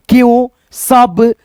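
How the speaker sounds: slow read-out. Shown as a demonstration. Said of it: "हम पढ़ करके आपको समझाते हैं".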